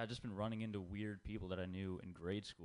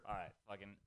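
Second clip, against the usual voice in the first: ordinary talk, nothing marked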